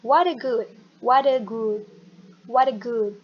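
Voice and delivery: "what a good" run together as whadda-good; linked words. Shown as a demonstration. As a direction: blend "what a good" into one unit. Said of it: "Digabung aja". ("what a good" digabung jadi satu)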